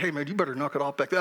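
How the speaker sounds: lightly stern dad warning, quick and low; a hint of a strict father calling to the back row; half-serious dad scolding the rowdy kids. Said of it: "I'd put on the dad face".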